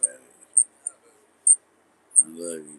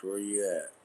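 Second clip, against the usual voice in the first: ordinary delivery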